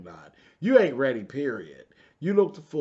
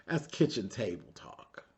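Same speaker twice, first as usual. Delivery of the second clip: usual speaking voice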